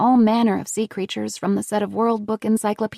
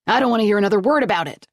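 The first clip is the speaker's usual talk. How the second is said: fast, louder irritated snap; fast angry snap; snapped; 3 dB louder, much faster than usual. Acted. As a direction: cut her off sharply. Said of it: "he snapped".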